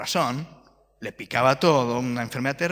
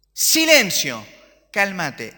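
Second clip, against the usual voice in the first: loud, higher command to the storm; commanding shout; 4 semitones higher, 8 dB louder, much slower than usual. Retold or imitated. Preacher as Jesus rebuking the storm, loud shout. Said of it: "¿A quién se lo dice? A la tormenta".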